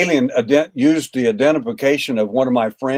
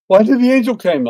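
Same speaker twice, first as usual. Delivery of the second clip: high, louder wife question; wife's voice; 10 semitones higher, 6 dB louder than usual. Retold or imitated. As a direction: voice her high and urgent. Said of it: "She said".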